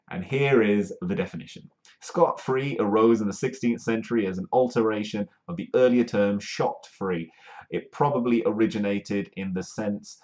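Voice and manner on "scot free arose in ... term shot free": ordinary talk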